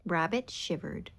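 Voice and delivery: usual speaking voice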